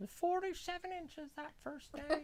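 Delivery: high, slow, soft granny voice; storytelling grandma. Pretend. Grandma recalling snow back in the day, high slow put-on voice. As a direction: play granny exaggerating the old days. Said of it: "listen to Grandma".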